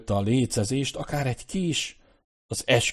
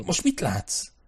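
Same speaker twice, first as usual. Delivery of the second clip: high, eager questioning; impatient; 7 semitones higher, slower than usual. A narrator's reading. Royan dancing beside Nicholas, impatient to know what he sees. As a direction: demand it eagerly, voice lifted high. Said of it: "táncolt mellette Royan a türelmetlenségtől".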